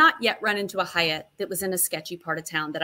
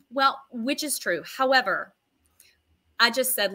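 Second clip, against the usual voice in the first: ordinary talk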